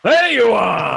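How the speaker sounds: big hearty champion's call; booming hail. Acted. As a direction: burst in and hail the whole room. Champion bursts in, hailing group.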